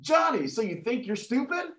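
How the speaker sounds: high-pitched teacher questioning a pupil. Acted. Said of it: "The teacher then says".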